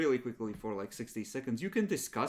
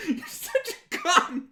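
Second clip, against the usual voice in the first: laughing insult; 6 dB louder, slower than usual. Real, not acted.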